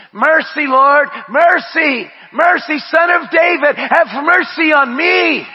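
loud, high cry for mercy; shouted plea for mercy; pleading cry. Acted. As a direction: cry out like a desperate beggar. Crying out for mercy.

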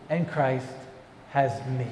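ordinary talk

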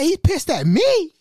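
high, drawn-out disbelief on "me"; slow, high-pitched "who, me?" reaction; acted-out shocked question; incredulous echo.

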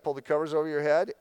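ordinary talk, nothing marked

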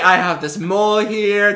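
high, loud, drawn-out claim; insistent mock proof. Pretend twin pointing out differences, loud high insistence. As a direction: insist loudly, on the edge of laughter.

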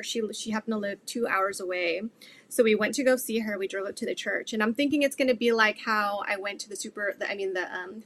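ordinary talk, nothing marked

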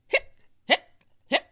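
evenly spaced sharp hics; abrupt hiccup bursts; three hiccups. A narrator's reading. Boy taking his turn, sharp hiccups. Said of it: "it was Hector's turn".

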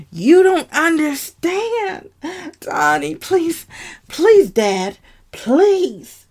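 high, slow, loud begging; desperate pleading. Acted. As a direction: plead with Dad as if it's your last chance.

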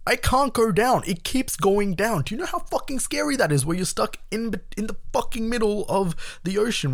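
high-pitched, alarmed retelling; worked-up, high storyteller's alarm; retold panic. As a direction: relive the panic, voice shooting up high. Reliving panic, stuck under water.

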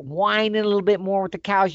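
ordinary delivery, nothing marked

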